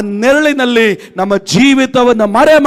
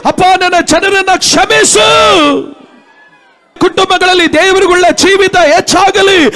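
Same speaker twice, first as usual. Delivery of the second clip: higher and louder, building to shouted declarations; rally the church into crying out Jesus' name; preaching at full force; 7 semitones higher, 3 dB louder than usual. Acted. (ಜೋರಾಗಿ ಕೂಗುತ್ತಾ ಏರುವ ಪ್ರವಚನ)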